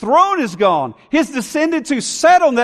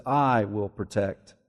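hushed promise; 14 semitones lower, 9 dB quieter than usual. Acted. God's promise spoken softly.

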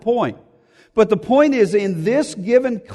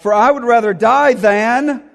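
higher and louder, punching "die", then cut off; emphatic abrupt line; 5 semitones higher, 5 dB louder than usual. Shown as a demonstration. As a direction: hit "die" hard and stop dead after "than". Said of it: "This is an abrupt ending".

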